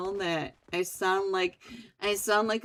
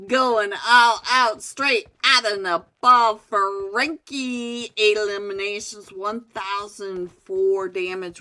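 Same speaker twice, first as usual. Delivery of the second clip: play-by-play; 2 semitones higher, 8 dB louder than usual. Acted. Highlight call.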